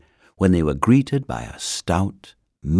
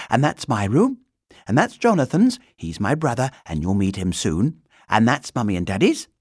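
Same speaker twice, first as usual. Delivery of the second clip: put-on higher, lively child's lilt; chattering girl; 6 semitones higher, 5 dB louder than usual. A narrator's reading. Girl giving the bear a tour, chattering. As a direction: rattle off the rooms like an eager girl.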